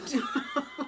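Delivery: high laugh; burst of laughter. Real, not acted. Laughs at the joke.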